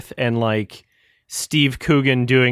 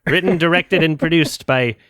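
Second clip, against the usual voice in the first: higher, slow mock British credits read; imitating a spiteful British narrator; 5 semitones higher, slower than usual. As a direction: drag out Shyamalan with mock spite. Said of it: "So he said".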